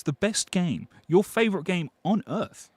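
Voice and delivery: ordinary delivery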